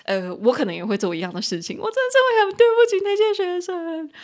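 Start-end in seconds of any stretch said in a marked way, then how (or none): 1.8-4.1 1 high-pitched sorry voice. Real, not acted.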